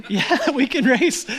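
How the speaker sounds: chuckling, stretched-out yes; amused agreement. Retold or imitated. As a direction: laugh while accepting a playful challenge. Amused acceptance of a race challenge.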